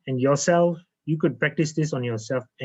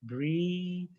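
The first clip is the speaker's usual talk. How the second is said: guided meditation. Acted. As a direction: breathe them down into calm, one phrase at a time. Leads a guided breathing meditation.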